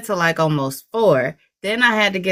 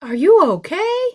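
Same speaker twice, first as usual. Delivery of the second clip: higher-pitched imitation of startled onlookers; 11 semitones higher than usual. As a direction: mimic everyone checking on someone who fell. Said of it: "everybody just looked and was like".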